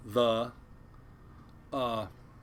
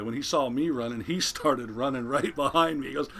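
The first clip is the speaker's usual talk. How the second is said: ordinary delivery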